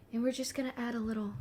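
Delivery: quick soft painter voice; soft fast painting instructor; Bob Ross. Pretend. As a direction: gently instruct the viewer. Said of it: "I'm Bob Ross now".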